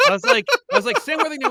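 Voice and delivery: cracking up at the wrong actor, laughing. Real, not acted. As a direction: giggle through the whole line. Laughing at the mix-up.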